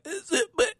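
squeaky high flustered laugh, lost for words. Retold or imitated. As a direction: caught off guard, laughing nervously upward. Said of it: "I was like".

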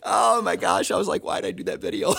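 high-pitched, laughing through the words; real laughter at your own expense; helpless laugh while talking; laughing in embarrassment. Real, not acted. Laughing at own past video.

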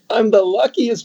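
high, laughing delight. Retold or imitated. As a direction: laugh through the words. Laughing while retelling his reply.

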